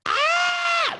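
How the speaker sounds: put-on a girl screaming in terror; horror scream. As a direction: scream like she's being stabbed. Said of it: "Like that".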